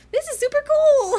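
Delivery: very high, loud excited squeal; mock thrilled shriek; hammed-up delight. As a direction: squeal like an awestruck kid, playfully imitated. Voicing Winter delighted in gear.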